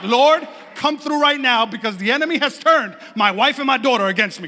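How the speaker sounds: hammed-up preacher's plea for rescue; mock desperate prayer. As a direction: call on the Lord in comic desperation.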